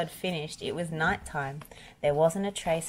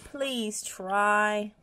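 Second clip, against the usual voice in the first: raised, urgent plea; pleading cry; 5 semitones higher than usual. A narrator's reading. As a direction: beg him to make the effort. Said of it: "cried Bob".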